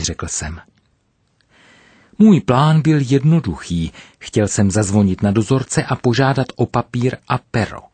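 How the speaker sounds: ordinary delivery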